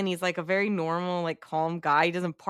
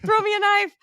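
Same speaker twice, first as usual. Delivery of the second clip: much higher, louder desperate yell; mock shout; 7 dB louder than usual. Jay to Jonah during the struggle, a high loud yell. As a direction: yell for the knife in a panic. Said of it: "And he's like".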